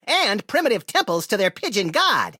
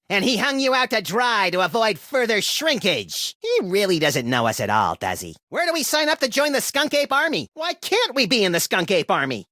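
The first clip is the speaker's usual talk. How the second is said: ordinary talk, nothing marked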